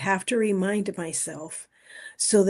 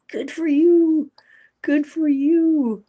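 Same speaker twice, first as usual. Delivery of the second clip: sputtering bad connection; choppy breaking-up audio; radio breaking up; 8 semitones higher, 5 dB louder than usual. Real, not acted. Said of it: "you are breaking up just a little bit".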